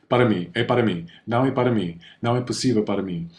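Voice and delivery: rattled off fast, words running together; very quick. Shown as a demonstration. Teacher showing how natives rush the phrases. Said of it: "it's gonna be very quick".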